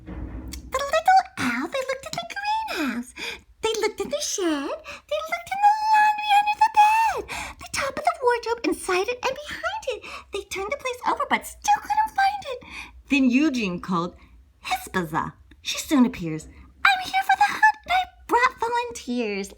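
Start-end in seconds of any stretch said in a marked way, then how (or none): 0.1-12.6 high-pitched storyteller rush; high, animated storytelling; frantic search narration. A narrator's reading. 16.9-19.5 high-pitched character voice; high, keen volunteer; eager animal helper. Acted. Helper animal arrives speaking.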